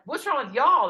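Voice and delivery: high, loud acted comeback; retold outraged counter-question; indignant retort. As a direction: fling the question back, hitting Y'ALL. Throws the question back at team.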